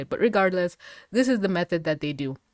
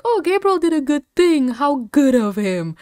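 high, loud sarcastic gush; sarcastic praise; 8 semitones higher, 5 dB louder than usual. Retold or imitated. Mocking Gabriel's good deed, high sarcastic gush. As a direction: sneer it like fake praise. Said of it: "I was like".

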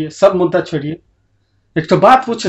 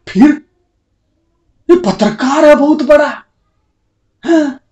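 mock surprise; 10 semitones higher, 4 dB louder than usual. Mocking the journalist's importance.

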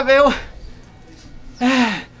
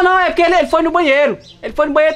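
ordinary talk

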